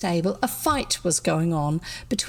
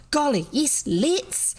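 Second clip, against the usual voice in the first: enthusiastic; 6 semitones higher, slower than usual. A narrator's reading. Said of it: "said the crowd enthusiastically".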